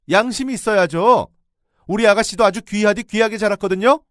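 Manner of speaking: high, loud outraged tirade building up; angry shouting. Retold or imitated. Narrator berates the in-law over the laundry. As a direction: scold the in-law at full volume. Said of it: "제 목소리가 아주 컸던지".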